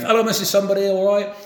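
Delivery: high, brisk hail to a passer-by; calling a greeting. Retold or imitated. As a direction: break off to call hello across the road. Dickie greeting passers-by mid-moan.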